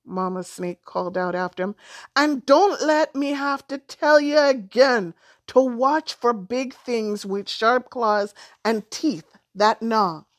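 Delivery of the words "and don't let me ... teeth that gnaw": mother's warning. A narrator's reading. Mother's warning called after son.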